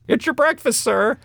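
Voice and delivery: high, chirpy staff voice at the door; high-pitched room service call. Retold or imitated. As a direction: announce breakfast brightly like hotel staff. Acting hotel staff at door.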